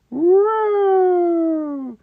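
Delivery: long, held high whoop; very high sing-out whoop; high woo. Real, not acted. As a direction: let out a sustained playful woo. Playful noise while sketching.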